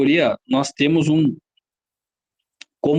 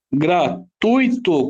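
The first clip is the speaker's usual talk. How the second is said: "gratuito" slowly split as gra - tui - to; slow, separated syllables in "gratuito"; much slower than usual. Shown as a demonstration. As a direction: count out "gratuito" in three chunks. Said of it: "Quantas sílabas?".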